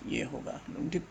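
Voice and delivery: whispered aside to himself. Real, not acted. Teacher whispering to himself while checking the answer. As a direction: breathe it out, almost silent.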